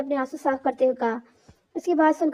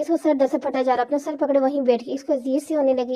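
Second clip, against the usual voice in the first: usual speaking voice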